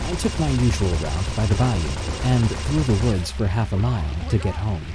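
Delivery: plain reading voice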